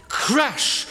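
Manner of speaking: high, loud sound-effect shout; crashing wave. Shown as a demonstration. Leader shows the group their wave sound, shouted crash. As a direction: burst out like a breaking wave. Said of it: "you guys are gonna go".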